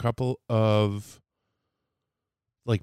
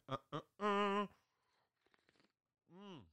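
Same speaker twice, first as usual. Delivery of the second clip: sounds while sipping coffee; mid-sip, mouth on the mug; 10 semitones higher, 18 dB quieter than usual. Real, not acted. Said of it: "Let me take a sip".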